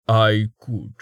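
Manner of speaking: slow, dry and wry; a hint of a weary giant; lightly voiced gruff old man. A narrator's reading.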